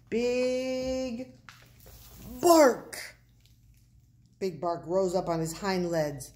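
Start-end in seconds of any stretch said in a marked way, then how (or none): 0.1-1.3 high, stretched build-up word; drawn out. A narrator's reading. Pip names the watchdog's qualification, drawn out. 2.4-3.2 loud, high dog-like bark. A narrator's reading. Pip shows the watchdog's big bark, barking.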